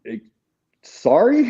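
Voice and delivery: as if you can't believe your ears; stunned disbelief. Retold or imitated. Retold disbelief at insult.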